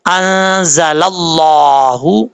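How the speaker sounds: انزل اللہ slowly chanted with the lam of اللہ thick; heavy recitation. Shown as a demonstration. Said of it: "تو یہاں پر موٹا پڑھیں گے". (اللہ کا لام موٹا، آہستہ تلاوت)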